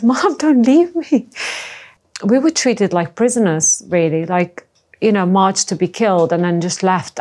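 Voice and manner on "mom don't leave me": high, slow, desperate plea to mum; retold childhood cry to mum, high and slowed; pleading child. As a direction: beg her not to go, like a frightened child. Child begging mum to stay.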